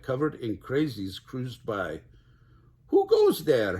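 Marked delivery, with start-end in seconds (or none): 2.9-3.8 high, loud challenge; hollering. A narrator's reading. Space dog challenging a passing stranger, hollering. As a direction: holler it out to whoever is there. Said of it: "hollered Skipito".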